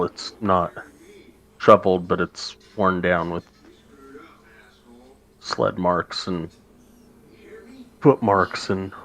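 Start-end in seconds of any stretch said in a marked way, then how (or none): none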